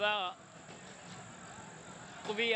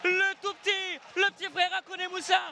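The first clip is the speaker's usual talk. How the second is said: excited sports commentary. Real, not acted. Goal call by commentator.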